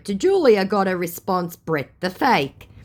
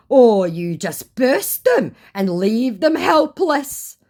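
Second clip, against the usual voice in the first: slow, higher, louder put-on scammer voice; mock scammer accusation; 8 semitones higher, 5 dB louder, slower than usual. Scammer accusing her of leaving them helpless, slow mock reproach. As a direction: draw the accusation out, mockingly.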